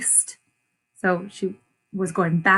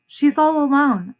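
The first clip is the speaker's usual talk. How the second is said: put-on higher, louder worried child; 6 semitones higher, 5 dB louder than usual. A narrator's reading. Esperanza finding the lonely kitten, higher with worry. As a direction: sound concerned for the kitten.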